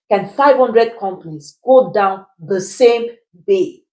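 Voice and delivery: spaced out slowly, phrase by phrase; slow down to make them reason it; deliberate, pointed re-asking; slow, emphatic question. Shown as a demonstration. Repeats the question emphatically, word by word.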